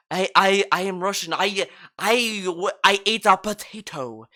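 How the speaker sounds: mock fake Russian accent, badly done; hammed-up, halting Russian-accented English. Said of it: "I can't do a Russian accent".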